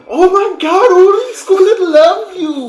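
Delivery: very high, loud breathless squeal; hyperventilating jubilation. Acted. Echoing the jubilant elf.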